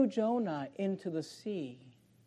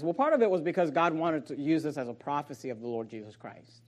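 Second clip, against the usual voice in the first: usual speaking voice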